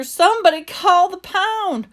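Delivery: high, loud wailing appeal; mock-tearful manager begging for help; tearful plea. A narrator's reading. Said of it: "he started to cry".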